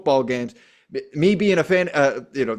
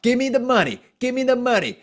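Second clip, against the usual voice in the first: pitched-up mock demand; mock whining player; 8 semitones higher than usual. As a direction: whine for the money like a spoiled star. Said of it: "complaining to Jerry fucking Jones".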